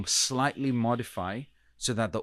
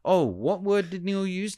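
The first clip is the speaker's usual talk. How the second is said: puzzled listener; 8 semitones higher than usual. Retold or imitated. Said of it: "forcing my audience to now think".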